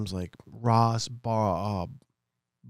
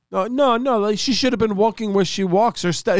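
mock landlord; 8 semitones higher, 3 dB louder than usual. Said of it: "He goes".

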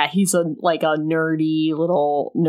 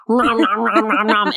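chomping noises. Retold or imitated. Mimes greedily eating gummy vitamins.

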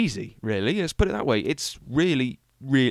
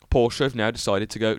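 ordinary talk, nothing marked

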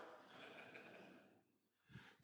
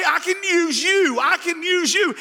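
emphatic calling; 7 semitones higher, slower than usual. Retold or imitated. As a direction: call them out with joy. God voiced choosing the lowly.

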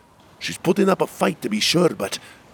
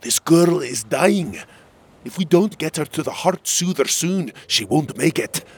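urgent demand; 5 semitones higher, 4 dB louder than usual. Acted. Prince urging guard to let dying girl pass, urgent. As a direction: press each word, rising in urgency.

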